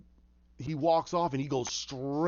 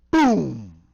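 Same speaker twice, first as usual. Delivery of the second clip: sudden loud explosion noise; loud imitated boom; 12 dB louder than usual. Storyteller makes the explosion, sudden loud boom. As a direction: blast out a mouth-made boom.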